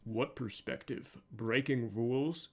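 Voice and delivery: soft, measured hero lines with accent kept; child Batman voice. Shown as a demonstration. Voice actor rehearses child Batman lines, soft with the accent kept. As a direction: try each phrase softly in the young hero's accent. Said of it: "made sure the accent was kept".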